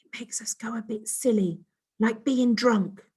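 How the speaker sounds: secretive alien whisper. Acted. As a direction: whisper so the boy can't hear. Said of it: "she whispered".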